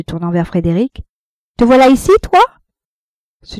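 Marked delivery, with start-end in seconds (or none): 1.6-2.4 high, loud, familiar questioning; surprised challenge. A narrator's reading. Rosanette finding Frédéric here, surprised and familiar. Rival spots her lover there.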